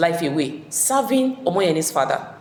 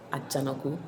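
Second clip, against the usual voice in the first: ordinary delivery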